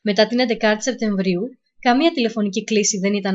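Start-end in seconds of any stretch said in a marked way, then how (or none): none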